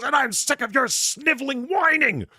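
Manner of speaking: high, loud hammed-up roar of contempt; enraged Q. Q berating Picard before hitting him, high and loud. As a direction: shriek it at the whining captain. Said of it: "He goes".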